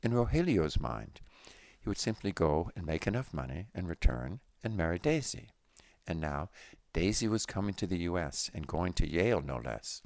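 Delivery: usual speaking voice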